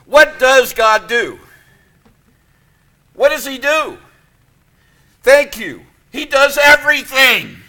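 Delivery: loud, high, ringing pulpit preaching; a pastor in full cry lifting up the congregation; full-force preaching. Acted. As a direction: preach it out to the back pews.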